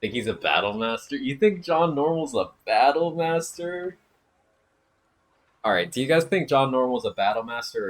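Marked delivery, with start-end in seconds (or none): 1.2-7.9 incredulous. Real, not acted. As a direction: act like it's absurd. Repeats chat question, then incredulous.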